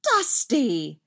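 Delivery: reproachful mom. A narrator's reading. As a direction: chide your son with a frown. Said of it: "pulls back to frown at him".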